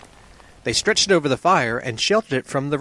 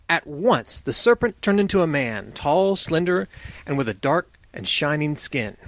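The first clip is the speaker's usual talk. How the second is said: usual speaking voice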